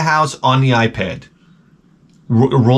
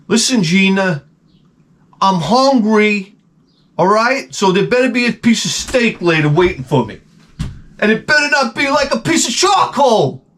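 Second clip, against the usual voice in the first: bossy husband yelling; 8 semitones higher than usual. Retold or imitated. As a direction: bark orders at his wife. Husband demands steak.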